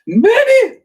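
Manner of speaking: squeaky call to a pet; high-pitched pet call. Real, not acted. Calling out to pet.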